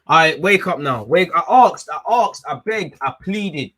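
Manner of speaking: loud exasperated outburst; frustrated shout. Real, not acted. Fan shouting in frustration at his sleepy team.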